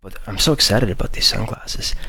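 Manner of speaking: breathless, talking through it; short of breath after talking. Real, not acted. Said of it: "I'm running out of breath here".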